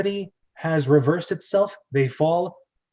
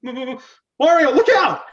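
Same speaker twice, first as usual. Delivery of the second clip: warning shout; 10 semitones higher, 9 dB louder than usual. Acted. Luigi warning Mario of the jaws, loud shout. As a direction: stammer then a much higher, louder cry.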